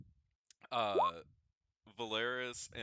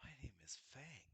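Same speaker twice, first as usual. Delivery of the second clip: soft crystal voice; 3 semitones higher, 12 dB quieter, faster than usual. Acted. Crystal answering the players.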